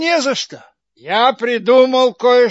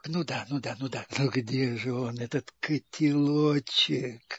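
low, secretive and eager; hushed whisper; 8 semitones lower, 12 dB quieter than usual. Acted. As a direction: whisper the question conspiratorially.

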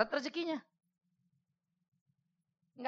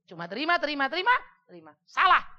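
building rebuke; 5 semitones higher, 8 dB louder than usual. Acted. Mengecam orang yang cuma menerima.

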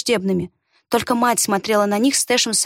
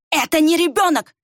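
high, spiteful outburst; vicious hissing; 6 semitones higher, 3 dB louder than usual. A narrator's reading. Villager denying that the boy is a child, vicious. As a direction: say it with venom, pitched up. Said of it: "прошипел Варх".

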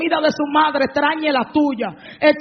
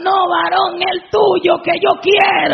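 higher, louder demanding come-on; mock insistent woman; 4 semitones higher, 4 dB louder, slower than usual. The woman insists on the husband's own number, higher loud demand. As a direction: press him like a shameless flirt.

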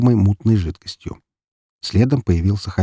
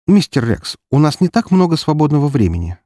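slightly unfriendly; 6 semitones higher than usual. A narrator's reading. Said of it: "добавила в свой тон капельку недружелюбия".

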